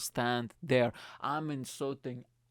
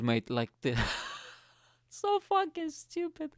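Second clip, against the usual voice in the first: high squeaky laugh through the words; cracking up mid-sentence. Real, not acted. Laughing at her shoe pick. Mocking the glittery shoes.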